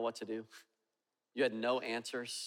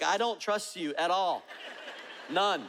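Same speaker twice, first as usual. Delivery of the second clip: emphatic refusal; 5 semitones higher than usual. Retold or imitated. Passenger telling the pilot he has no trust, emphatic refusal. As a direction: shoot the pilot down, pitched up and emphatic. Retold argument with pilot mid-takeoff.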